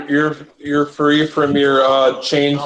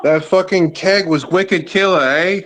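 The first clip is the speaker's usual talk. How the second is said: higher, put-on Boston accent; 5 semitones higher than usual. Shown as a demonstration. Friend asked for Boston slang, showing a Boston accent. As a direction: lay on a Boston accent, dropping the Rs. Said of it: "say it in a Boston accent".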